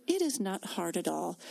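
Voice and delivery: ordinary talk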